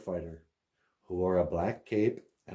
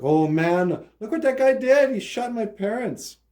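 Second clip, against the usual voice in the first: put-on much higher and louder child's cry of dismay; heartbroken little boy; 13 semitones higher, 7 dB louder than usual. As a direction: play a little boy upset at what he saw. Heartbroken boy voiced in story.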